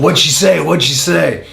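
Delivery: lightly imitated eager colleague; Mark Henry. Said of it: "Mark Henry's sitting beside me going".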